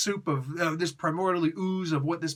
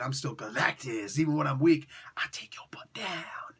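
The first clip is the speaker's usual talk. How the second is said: weakened villain boast; 3 semitones lower than usual. Retold or imitated. Weakened Galactus threatens, voice trailing.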